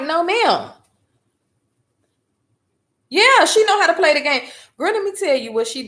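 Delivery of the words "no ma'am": firm refusal. Retold or imitated. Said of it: "And I'm like".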